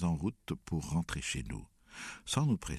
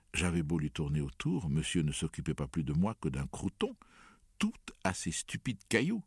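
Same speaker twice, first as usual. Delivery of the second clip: usual speaking voice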